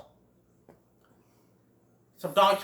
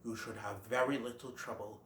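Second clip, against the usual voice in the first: much lower, much softer solemn voice; grave stag; 7 semitones lower, 15 dB quieter than usual. Retold or imitated. Great Prince to Friend Owl, low solemn.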